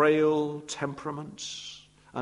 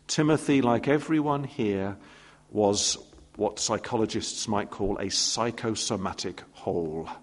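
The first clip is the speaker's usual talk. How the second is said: normal talking voice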